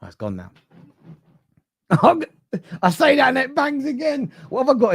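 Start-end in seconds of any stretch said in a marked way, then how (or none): 1.9-4.9 high laugh through the words; laughing fright. Real, not acted. Streamer startled when the door bangs again, laughing. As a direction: laugh it off, jumpy.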